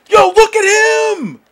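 slow, high, loud holler; incredulous yell. Real, not acted. Gamer yelling about who he targets, incredulous holler. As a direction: holler it, stretched out in frustration.

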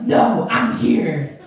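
loud, drawn-out declaration; mock-heroic and slow; emphatic announcement. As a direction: take over the room with it. Announces herself, taking control.

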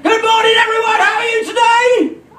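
put-on high, bright children's entertainer greeting; big cheery call to a crowd of kids; kids party magician.

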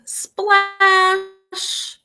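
high, drawn-out splash with long hiss; big splash. A narrator's reading. Froggy hits the pond, high long splash. Narrated sound of hitting pond.

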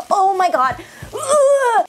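high, acted-out recoil from a hug; hammed-up disgust, pitch leaping up; mock exasperation. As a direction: squirm away with a high groan. Acts recoiling from mother's hug.

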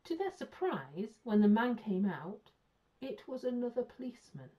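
ordinary delivery

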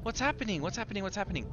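high, small, rapid-fire questions; anxious. Real, not acted. Player lost in a strange scene, anxious questioning.

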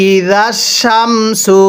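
ই জাস Shamsu drawn out slowly, each syllable held; slow teaching demo of ই জাস Shamsu. Shown as a demonstration. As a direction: spell it out slowly for students. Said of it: "আবার".

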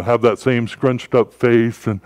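ordinary delivery

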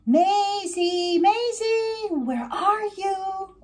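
high singsong call, stretched out. A narrator's reading. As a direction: call for a lost friend in long, high notes. Max calls for his missing friend.

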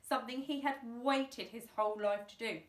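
usual speaking voice

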